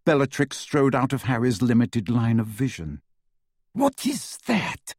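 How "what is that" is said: higher, slow, hushed; startled witch. A narrator's reading. Bellatrix sees the sword.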